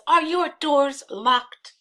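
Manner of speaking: high, loud, slow call; acted shout from the street; yelling. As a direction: yell it up to the window, word by word. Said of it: "and then Iago yells".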